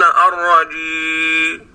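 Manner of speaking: الرجي said slowly with the final meem swallowed; dropped final meem. Shown as a demonstration. Teacher imitating rural reciters who drop the meem. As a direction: stretch الرجي and leave the meem off. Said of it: "وهم أكثر أهل الريف، يقولون".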